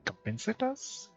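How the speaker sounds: usual speaking voice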